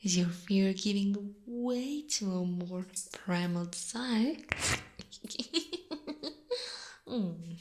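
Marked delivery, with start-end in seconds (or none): 4.4-7.0 high, wicked little giggle; teasing giggle. Acted. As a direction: titter high, toying with your prey. Spider relishes prey's desire.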